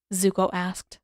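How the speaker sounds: usual speaking voice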